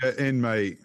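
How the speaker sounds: mock English football-fan cheer; a quick jokey English accent. Celebrating in a mock English accent. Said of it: "I don't know why you did an English accent there".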